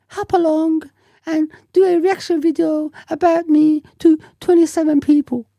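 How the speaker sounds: mock him as a prissy little girl. Mocking a troll's message in a high girly voice. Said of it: "Like a little girl".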